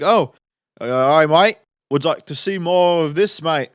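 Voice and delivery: put-on Australian accent. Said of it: "It was like".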